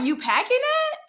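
high, surprised "You packing it?"; seeing him in the shower, impressed; impressed surprise. Retold or imitated. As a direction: lift it up, impressed. Impressed by his size.

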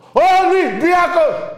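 huge, high, drawn-out yell; demonstrated shout. Shown as a demonstration. Host showing how he shouts for his team, full-force yell. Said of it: "Εγώ φωνάζω".

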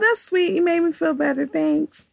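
grateful sweet voice. Retold or imitated. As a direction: sound touched and grateful. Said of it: "that was just like".

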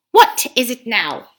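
loud, pushy ape lecturing the beasts; a sly ape bullying the crowd; scheming ape. Acted. Ape haranguing the gathered beasts, loud and pushy.

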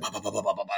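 quick, soft bah-bah-bah; rapid low-volume thumping syllables; imitated pounding heartbeat. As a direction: drum out a racing heart with the voice. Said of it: "my heart's like".

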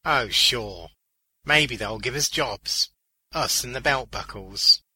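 loud, slightly slow sarcasm; loud mock agreement; scoffing joke. A narrator's reading. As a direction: toss it off as a wry crack. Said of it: "It was Beaners' turn to laugh".

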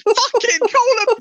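panicked high yell, slow and loud; shrieking at the drivers; screaming. Retold or imitated. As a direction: scream it out in terror. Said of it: "Screaming it".

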